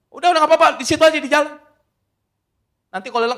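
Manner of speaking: loud, high-pitched brush-off; mock guard calling out loudly; acted dismissive guard. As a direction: wave him off as an impatient security guard. Guard brushes off motorbike parking request.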